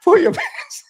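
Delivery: laughing high-pitched echo. Retold or imitated. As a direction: giggle through the words.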